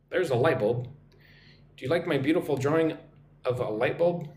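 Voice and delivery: normal talking voice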